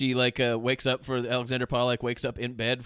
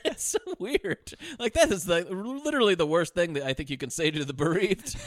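ordinary talk

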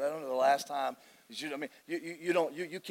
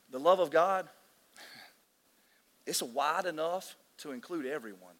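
slow and simple. Shown as a demonstration. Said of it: "put it to you a real, really simple way".

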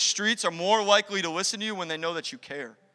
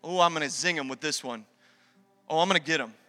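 smug; 6 semitones lower, 5 dB quieter, faster than usual. Retold or imitated. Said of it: "we as a church are like".

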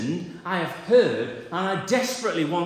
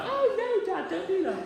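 much higher, laughing mock panic of a boy; 13 semitones higher, faster than usual. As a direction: squeal like a caught kid, laughing. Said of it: "Little panic there".

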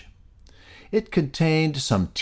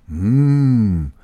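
yummy mmm; 3 semitones higher, 9 dB louder than usual. A narrator's reading. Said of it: "exclaimed between bites".

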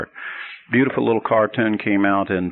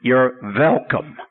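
put-on slow rabbi accent with V for W in "welcome"; accented "velcome", drawn out as the rabbi; rabbi's accent; slower than usual. Rabbi replying to thanks from students, V-for-W accent. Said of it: "And he says".

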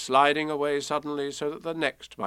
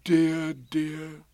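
Pooh; 4 semitones higher than usual. A narrator's reading. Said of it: "said Pooh".

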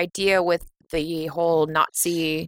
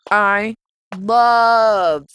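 "love" stretched long, higher and louder; emphatic LOVE; 3 semitones higher, 6 dB louder than usual. Real, not acted. Co-host names favourite scene, emphatic drawn-out "love". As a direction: draw out "love" with gushing emphasis.

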